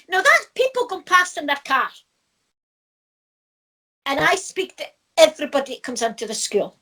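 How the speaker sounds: ordinary delivery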